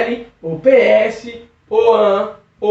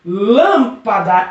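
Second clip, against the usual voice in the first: "Lâmpada" with a long, strong LÂM; strong antepenultimate syllable; much slower than usual. Shown as a demonstration. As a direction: lean slowly on the LÂM of "Lâmpada". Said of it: "proparoxítona". ("Lâmpada" com o LÂM bem marcado)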